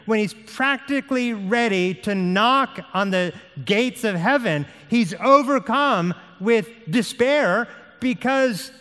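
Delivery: high, driving pulpit storytelling; impassioned preaching. Real, not acted. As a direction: build urgently, leaning hard on key words. Preaching builds to despair.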